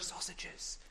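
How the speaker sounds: soft unvoiced whisper. Showing the children a faraway whisper: whisper. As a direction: whisper it as if from the kitchen. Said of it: "and I whispered".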